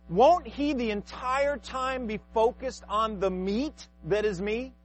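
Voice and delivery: ordinary talk, nothing marked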